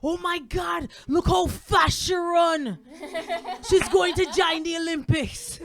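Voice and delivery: high, loud marvelling grandfather, stretched words; comedian's admiring dad. Retold or imitated. Dad admiring granddaughter running off, hammed-up marvel. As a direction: boast proudly in an imitated father's voice. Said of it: "My dad's just like".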